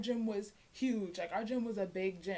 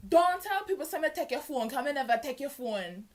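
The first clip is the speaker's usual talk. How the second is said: a hint of Jamaican patois, loud at first; lightly voiced Jamaican patois warning; patois threat; 4 semitones higher, 6 dB louder than usual. Senior threatening her in Jamaican patois, loud. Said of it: "intimidate me by talking patois".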